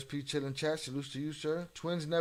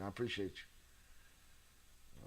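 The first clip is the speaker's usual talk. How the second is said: whispered thanks; 5 semitones lower, 13 dB quieter, much slower than usual. Real, not acted. Soft thanks to chat.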